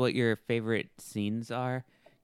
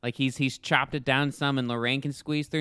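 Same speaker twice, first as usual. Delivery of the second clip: usual speaking voice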